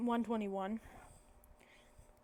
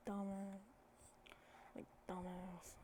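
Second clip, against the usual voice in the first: under the breath; 14 dB quieter, slower than usual. Retold or imitated. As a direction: mutter it under your breath. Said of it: "He says dumbass under his breath".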